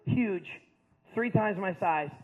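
usual speaking voice

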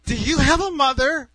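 high, loud challenge to a stranger; wife's outraged demand; indignant yell. Retold or imitated. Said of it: "she's yelling at this guy".

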